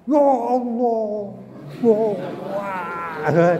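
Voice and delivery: drawn-out, slow, hammed-up wailing of Allah; mock shouting prayer. Teacher mocks pupils who shout their prayers, slow wail. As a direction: wail it slowly in mock exaggeration. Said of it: "Tapi tidak dengan teriak-teriak".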